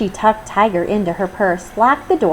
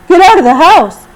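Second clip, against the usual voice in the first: much louder warning cry; alarmed shout; 10 semitones higher, 12 dB louder than usual. Acted. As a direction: yell it as the tiger alarm. Zoo man warns of tiger.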